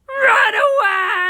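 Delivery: high, loud drawn-out shout; panicked scream. Acted. Everyone fleeing crocodile ghost, panicked shout. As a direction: scream it long, in mock terror.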